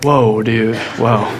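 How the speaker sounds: laid-back God voice. Retold or imitated. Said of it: "the Lord was like".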